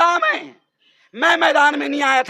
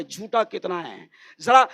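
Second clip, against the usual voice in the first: ordinary talk, nothing marked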